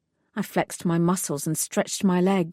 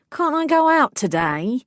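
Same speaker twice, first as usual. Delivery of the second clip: higher, louder whiny plea; whining; 6 semitones higher, 4 dB louder than usual. Acted. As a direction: beg like a sulky man. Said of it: "had a whining quality".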